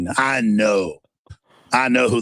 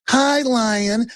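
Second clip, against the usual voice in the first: naive gazelle voice; 13 semitones higher than usual. Retold or imitated. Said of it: "as the little gazelle walks over".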